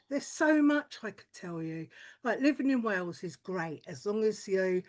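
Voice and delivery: usual speaking voice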